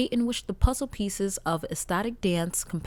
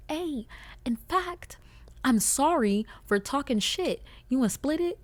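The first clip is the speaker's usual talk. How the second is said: mock sweet request; 6 semitones higher than usual. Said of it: "I'd be like".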